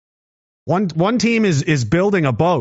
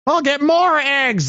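high, slow taunting voice; mock enemy; 10 semitones higher, slower than usual. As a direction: play the petty egg thrower, drawn out. Voicing the egg-throwing enemy.

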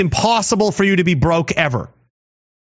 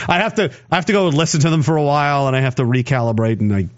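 ordinary talk, nothing marked

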